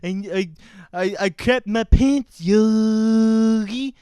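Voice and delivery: slow, hammed-up cartoon French accent; mock French accent for a silly cartoon cub; Boo Boo, mock French accent. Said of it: "And Boo Boo goes".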